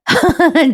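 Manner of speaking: उंच स्वरातलं छोटं हसू; short high giggle; खळखळून हसणं. Real, not acted. निवेदिका स्वतः हसते. (खरं, छोटं हसू)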